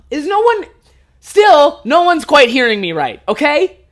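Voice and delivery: high, loud frustrated outburst; exasperated plea. Acted. Acted frustration at not being heard.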